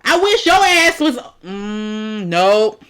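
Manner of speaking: high, loud furious snap; yelling at the screen in anger; angry outburst. Real, not acted. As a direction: lash out in disgust.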